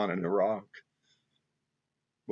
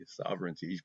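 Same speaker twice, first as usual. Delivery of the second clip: Sovereignty repeated much softer, to herself; soft echo; 2 semitones lower, 11 dB quieter than usual. Real, not acted. Vlogger softly repeats her key word to the viewer. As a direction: let Sovereignty fall away into a soft echo.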